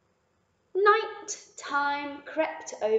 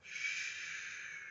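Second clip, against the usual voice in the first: soft unvoiced shush; whispered shh; 11 dB quieter than usual. The cow whispers shh to Lily. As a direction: hush her like a sleepy calf. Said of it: "whispered the cow".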